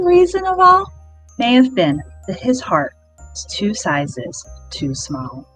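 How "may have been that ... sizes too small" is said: ordinary delivery, nothing marked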